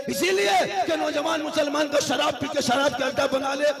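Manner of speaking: high, impassioned sermon to a crowd; fiery, ringing oratory; full-force preaching. Acted.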